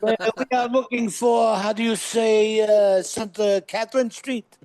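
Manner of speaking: lightly put-on French accent; a hint of a bad French accent; fake French accent. Friend asking directions in Montreal, bad French accent. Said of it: "he spoke in a French accent".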